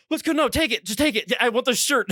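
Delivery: high-pitched mild panic; half-played panicky pleading; retold freak-out. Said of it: "I was, like, freaking out".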